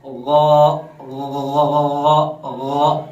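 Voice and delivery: imitated salt-water gargle; gargling sound. Shown as a demonstration. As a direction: gargle in the back of the throat. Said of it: "गलगला करते हैं तो किस तरह की आवाज". (गरारे जैसी आवाज़)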